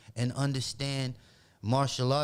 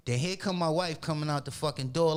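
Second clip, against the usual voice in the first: ordinary delivery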